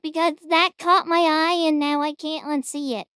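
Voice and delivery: ordinary talk, nothing marked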